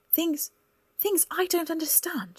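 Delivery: high, incredulous repeat of things; incredulous echo. Acted. Cora echoes his words incredulously.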